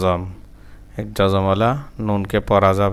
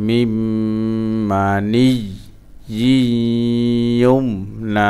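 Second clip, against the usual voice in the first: joined recitation; much faster than usual. Shown as a demonstration. Teacher putting the word back together, joined chant. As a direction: link it all, holding the long sounds.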